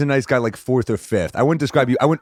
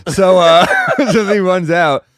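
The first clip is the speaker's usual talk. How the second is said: talking through laughter, higher and louder; laughing; 7 semitones higher, 8 dB louder, slower than usual. Real, not acted. Comic telling host about being attacked, laughing. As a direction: let the laugh carry into the words.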